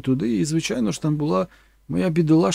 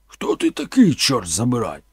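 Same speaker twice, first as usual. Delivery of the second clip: hostile challenge; 4 dB louder than usual. Acted. Boss confronts Forrest, hostile shout. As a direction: snap at the stranger. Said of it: "запитав він".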